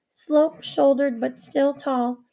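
usual speaking voice

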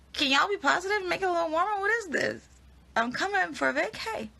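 high, loud playful pleading; mock protest. Real, not acted. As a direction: plead with the fans in mock outrage. Mock protest at gloomy weather comments.

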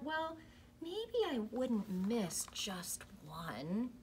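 soft, slow wavering thought; thinking quietly. Acted. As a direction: slowly talk yourself into it. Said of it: "he thought".

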